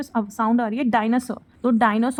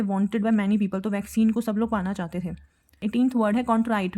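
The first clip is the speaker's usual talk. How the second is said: normal talking voice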